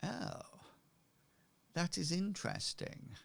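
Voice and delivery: high, soft, intrigued musing. Acted. Merchant learning of suspicious goings-on at the docks, intrigued. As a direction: let a high, quiet "oh" lead into musing.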